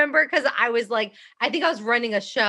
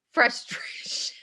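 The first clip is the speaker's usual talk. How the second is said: whisper; 4 dB quieter, much slower than usual. Real, not acted. Trails off into a whisper.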